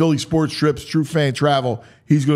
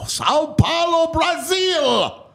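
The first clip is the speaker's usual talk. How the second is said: excited; 14 semitones higher than usual. Real, not acted. Host hyping Brazil opener.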